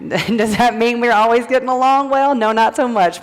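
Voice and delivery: a laugh spilling into the question; amused, teasing herself. Real, not acted. Laughs at her own question.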